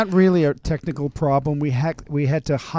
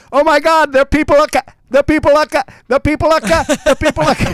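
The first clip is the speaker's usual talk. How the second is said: much higher, louder alarmed broadcast; panicked radio voice; 13 semitones higher, 10 dB louder than usual. Retold or imitated. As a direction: cry it out in panic like the old broadcast. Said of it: "The record was changed until it was".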